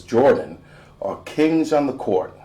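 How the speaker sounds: ordinary delivery, nothing marked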